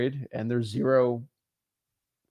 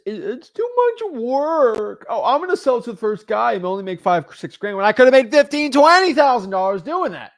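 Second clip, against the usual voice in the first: mock whining; 9 semitones higher, 6 dB louder than usual. Coach mimics lazy wholesalers balking at the work, mock whining. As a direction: whine it like a lazy slacker's excuse, building louder.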